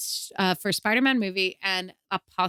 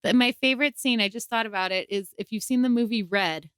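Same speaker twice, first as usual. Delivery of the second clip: ordinary delivery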